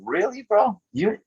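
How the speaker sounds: high-pitched disbelief, half laughing; incredulous. Retold or imitated. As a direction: question them as if you can't believe your ears. Retold disbelief at scouts' opinions.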